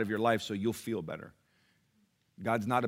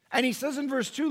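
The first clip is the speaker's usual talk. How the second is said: high, loud, impassioned preaching; preaching at full force; 10 semitones higher, 8 dB louder than usual. Acted. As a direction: pour it out to the congregation with rising fervour.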